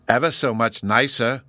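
usual speaking voice

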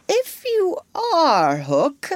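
high, slow, coaxing voice; ingratiating, almost humble. A narrator's reading. Hook tries winning over the mystery voice, humbly. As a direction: wheedle humbly, stretching the words. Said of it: "he said almost humbly".

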